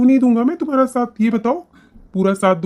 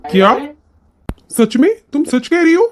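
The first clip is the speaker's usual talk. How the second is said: loud, rising disbelief; can't believe the good news; amazed husband; 5 semitones higher, 5 dB louder than usual. Acted. Husband learns wife educated.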